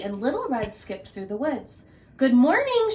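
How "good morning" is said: high, loud cheerful call; loud sing-song greeting. Acted. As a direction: call across the woods to the animals. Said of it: "she called to the rabbits".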